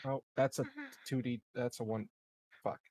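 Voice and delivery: normal talking voice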